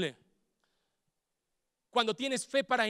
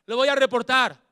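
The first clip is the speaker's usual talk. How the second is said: louder fed-up threat; mock threat; 3 semitones higher, 11 dB louder than usual. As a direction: threaten to report the caller. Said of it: "dejan de insistir".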